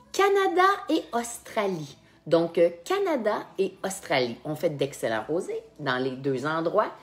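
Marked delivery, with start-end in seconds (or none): 0.1-2.0 high-pitched game-host announcement; high, drawn announcement to the players; announcing the choices. Real, not acted. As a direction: call out the two options, pitched high.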